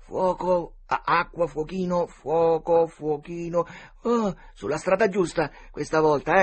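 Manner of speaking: put-on high, loud, excited boy voice; excited commentary. Boy tracking the searchers' steps, excitedly. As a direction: call hot and cold with mounting excitement.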